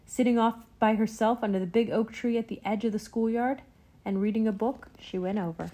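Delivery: usual speaking voice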